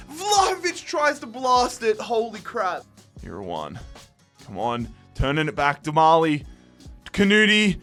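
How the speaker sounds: normal talking voice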